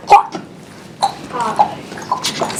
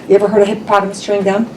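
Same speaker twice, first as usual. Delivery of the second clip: usual speaking voice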